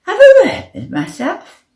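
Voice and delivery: high, loud disbelieving question; incredulous echo. A narrator's reading. Stuck player questioning the advice, incredulous. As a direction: throw the advice back as a question.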